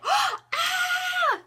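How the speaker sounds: very high, loud fake scream; hammed-up shriek. Shown as a demonstration. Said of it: "And then I look at it".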